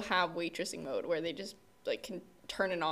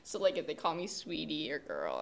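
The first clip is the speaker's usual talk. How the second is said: normal talking voice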